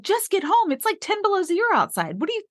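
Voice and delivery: high and fast, rushing the words; fast, high-pitched motherly urgency; urgent plea. Retold or imitated. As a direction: hurry him home, pitched high with worry. Mother urging son home in cold.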